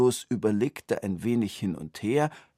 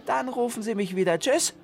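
very high, fast brisk genie voice; the genie has had enough, rattles it off; fed-up genie; faster than usual. A narrator's reading. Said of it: "Jetzt wurde es dem Geist zu blöd". (sehr hohe, schnelle, genervte Geisterstimme)

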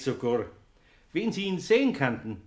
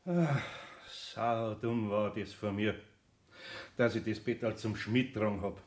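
regretful sigh; 5 semitones lower, 7 dB quieter than usual. Acted. Eder alone in the workshop blaming himself, sadly. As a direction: sigh it out softly. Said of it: "seufzte er tief".

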